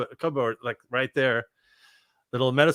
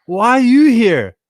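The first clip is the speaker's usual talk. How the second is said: astonished question; 7 semitones higher, 7 dB louder than usual. Retold or imitated. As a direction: stretch it out in total disbelief. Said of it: "And I'm like".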